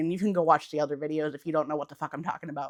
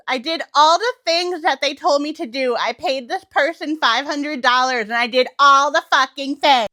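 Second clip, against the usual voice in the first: higher, louder whiny complaint; mock complaining manifester; 6 semitones higher, 7 dB louder than usual. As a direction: play the fed-up customer of a guru. Mocking a frustrated manifester.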